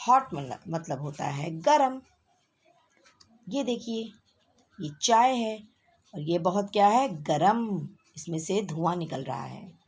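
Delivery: ordinary talk, nothing marked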